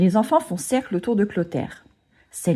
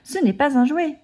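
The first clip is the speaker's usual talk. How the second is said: exclaiming; 7 semitones higher than usual. A narrator's reading. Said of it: "s'écrie".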